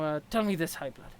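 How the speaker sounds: ordinary delivery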